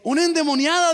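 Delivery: startled shout of a demon; alarmed cry. Retold or imitated. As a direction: sound the alarm.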